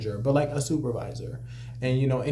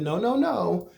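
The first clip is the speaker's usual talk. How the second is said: rising emphatic no; 9 semitones higher, slower than usual. Retold or imitated. Rising refusal.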